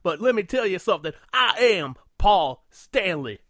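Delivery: loud, high rock-star stage shouting. Retold or imitated. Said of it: "should have started shouting".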